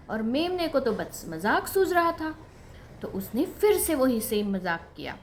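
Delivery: plain reading voice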